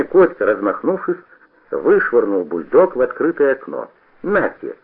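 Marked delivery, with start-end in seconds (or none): 4.2-4.7 high, short burst of spite; a touch of malice, thrown out higher; spiteful hiss. A narrator's reading. Boy flings the revolver out the window, spitefully. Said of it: "злобно прошипел он".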